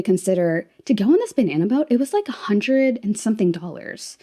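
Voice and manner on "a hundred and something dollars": ordinary talk, nothing marked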